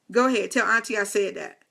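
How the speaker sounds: ordinary talk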